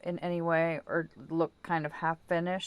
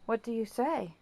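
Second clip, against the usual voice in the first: higher, sing-song coaxing question; coaxing the dog; 4 semitones higher than usual. Real, not acted. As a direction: egg the dog on gently.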